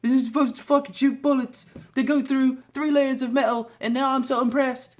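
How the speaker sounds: high-pitched mock British accent. Mocking people impressed by bullets, mock British accent. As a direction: put on a high British voice for mockery. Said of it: "why the fuck I went British".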